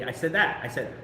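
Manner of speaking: ordinary delivery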